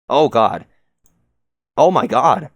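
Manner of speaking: loud gasped-out dismay; shocked exclamation. Real, not acted. Reader shocked by scene.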